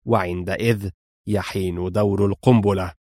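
low, restrained gruffness; gruff continuation. A narrator's reading. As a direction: carry on coldly, ignoring the question. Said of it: "واصل في خشونة". (خشونة بصوت منخفض)

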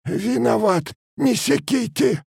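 very high, pleading peasant voice; squeezed-out plea. A narrator's reading. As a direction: beg not to be whipped, voice squeezed high. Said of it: "но сквозь рукавицы выдавилось". (сдавленная мольба высоким голосом)

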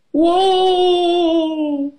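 long, high "whoa"; sliding yell. A narrator's reading. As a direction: let out a drawn-out cry as if slipping away. Scared father slides down slide.

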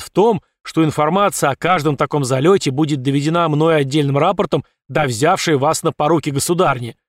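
loud, stiff drill commands; stiff barking toy soldier. Acted. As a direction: bark orders at the ranks. General barks drill orders.

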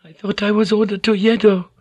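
high, meek musing; high-pitched private protest; half to herself. A narrator's reading. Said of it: "then half to herself".

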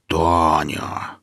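low, drawn-out reproach; chiding, lingering on the vowel; reproachful drawl. A narrator's reading. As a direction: stretch the name out in reproach. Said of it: "укоризненно протянул он".

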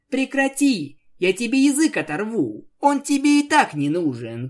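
sharp angry shout, high. Acted. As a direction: yell it at her in anger. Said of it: "крикнула Пегаска".

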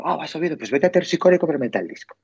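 petición animada, más aguda; encargo entusiasta. Retold or imitated. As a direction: imitar un encargo a un colega con tono agudo. Encarga un disco al colega. (encargo entusiasta y agudo)

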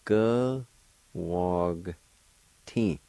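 careful teacher's breakdown of "Go, wog, tin"; syllable-by-syllable pronunciation. Shown as a demonstration. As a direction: sound out "Go, wog, tin" with pauses. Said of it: "pronounce the G sound with the W A like this".

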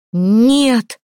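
loud, feral shout; half-animal cry. A narrator's reading. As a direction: howl it like a cornered beast. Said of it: "полузвериным криком ответила она". (полузвериный крик)

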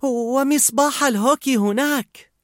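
high, slow character voice, tension forgotten; high-pitched, drawn-out suggestion; Fear. A narrator's reading. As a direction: chime in eagerly, stretching the words. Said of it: "نسي خوف توتره وأضاف".

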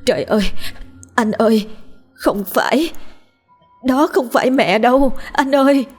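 high-pitched, gasping panic; terrified pleading. Acted. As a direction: warn him in mounting terror. Wife terrified, warning husband on phone.

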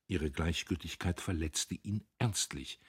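plain reading voice

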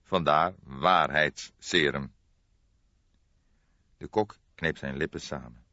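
plain reading voice

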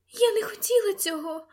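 high-pitched frightened lady; frightened plea. A narrator's reading. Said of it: "прошепотіла вона". (злякано, високим голосом)

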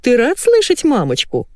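high, cooing pet talk; baby talk. A narrator's reading. Wife cooing to her dog by phone, baby talk. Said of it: "засюсюкала Гарпия".

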